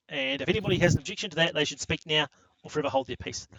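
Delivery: ordinary talk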